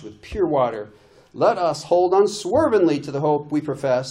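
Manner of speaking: loud, rousing pep-talk read; inspiring football coach. Shown as a demonstration. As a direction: fire up the team like a coach. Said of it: "like an inspiring football coach or something".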